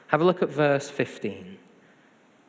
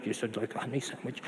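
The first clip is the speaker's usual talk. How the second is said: soft, quick, lower sulky mumble; grumpy child; 4 semitones lower, 10 dB quieter, faster than usual. Retold or imitated. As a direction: imitate a kid grudgingly giving in, fast and low. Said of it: "Harry would get all grumpy".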